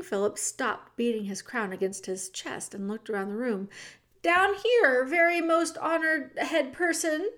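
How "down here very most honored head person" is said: high, raised little mouse voice; calling up. Acted. The mouse calls up to the king from the floor, high. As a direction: call up from the floor. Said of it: "said Despereaux".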